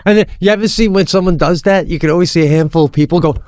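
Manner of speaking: normal talking voice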